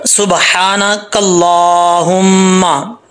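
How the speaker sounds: drawn-out Quranic recitation; slow recitation. Shown as a demonstration. Teacher reciting the Sana slowly for students. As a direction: chant each syllable long and slow.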